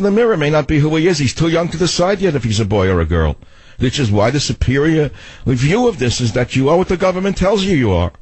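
Doctor and Savage character. Acted. Host playing a doctor giving a verdict on a boy, put-on voice. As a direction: play a pompous-talking radio doctor character. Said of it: "I gave you a new Doctor and Savage voice".

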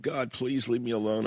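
high, whiny complaining voice; hammed-up self-pity in a high voice; whiny Moses. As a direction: whine out every ailment like a nervous Moses.

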